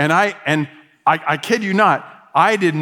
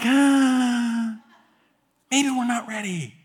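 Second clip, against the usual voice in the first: mock reluctance; 9 semitones higher, much slower than usual. Church balking just as things start, mock reluctance. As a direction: shrink back in a small, high voice. Mock backing out after the hype.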